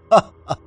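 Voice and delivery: short, loud, high laugh; bitter laugh. A narrator's reading. Rejected friend answers He Yuan's question, bitter laugh. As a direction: force out two bitter ha-has. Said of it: "陆明轩苦笑道".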